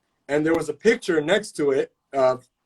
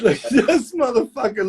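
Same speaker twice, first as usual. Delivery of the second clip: high, slow laughing jab at a friend; mock insult to a buddy, laughing; 7 semitones higher, slower than usual. Real, not acted. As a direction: laugh it out at him.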